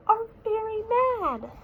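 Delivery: high small bear voice warning dad; a child's alarmed warning; worried little cub. Acted. Small bear warns his dad the bees are angry, high and worried.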